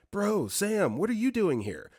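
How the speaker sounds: high-pitched mock shout, no real volume; imitated shout. Inmate spots his buddy Sam, imitated shout. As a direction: call out in surprise, lightly acted. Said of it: "I hear a guy shout out".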